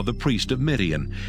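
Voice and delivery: normal talking voice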